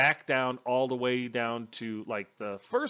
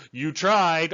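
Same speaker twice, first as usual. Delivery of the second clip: lightly slurred retort, a little louder; mock slurring; 4 semitones higher, 6 dB louder than usual. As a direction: imitate slurred speech from an injured face. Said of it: "all slurring and stuff".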